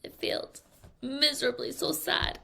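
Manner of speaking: soft, half-crying mock sadness; mock sad. Girl whose surprise failed, soft mock sob. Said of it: "So she was like".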